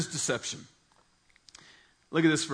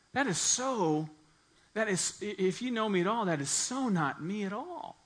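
high, emphatic protest; indignant denial; 6 semitones higher than usual. Retold or imitated. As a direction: protest your innocence, pitched up.

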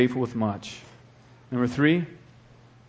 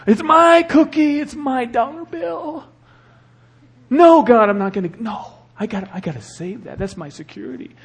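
put-on cling to every dollar like a child; whiny greedy believer; 9 semitones higher, 7 dB louder than usual. Pastor mocking stingy Christians holding onto money.

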